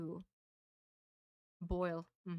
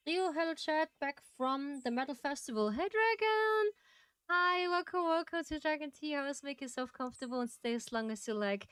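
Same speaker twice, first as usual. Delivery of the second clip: bright, high, excited streamer greeting; cute anime streamer; 5 semitones higher than usual. Real, not acted. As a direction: squeal hello to the chat.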